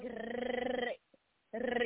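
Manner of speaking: soft trilled "rrr", tongue rolling the R; rolled R demo. Shown as a demonstration. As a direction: show how to roll the R in "rrr". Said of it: "It's just like".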